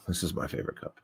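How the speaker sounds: unvoiced whisper. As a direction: whisper it to the camera. Whispered line about cup.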